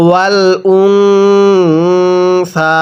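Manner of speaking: ওয়াল উনসা with the ন hummed long through the nose, very slow; nasal ghunnah recitation. Shown as a demonstration. Teacher demonstrating ghunnah on নুন সাকিন, slow nasal chant. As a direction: hold the nasal hum in উনসা. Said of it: "গুন্নাহ করে পড়ব".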